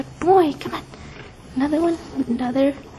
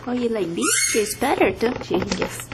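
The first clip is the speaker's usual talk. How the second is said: talking to a dog; 5 semitones lower, 5 dB quieter than usual. Real, not acted. Cooing to dog.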